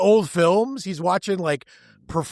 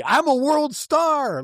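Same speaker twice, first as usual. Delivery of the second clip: high, drawn-out boast, lightly yelled; imitated yell, more pitch than volume; mock athlete yell; 8 semitones higher, slower than usual. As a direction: stretch it out high like a bragging athlete. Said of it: "He's yelling through the whole movie".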